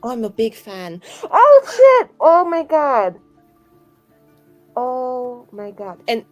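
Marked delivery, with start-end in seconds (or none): 1.2-3.2 high, loud burst of shock; shocked exclamation. Acted. Player learning he is a Crow, loud shock.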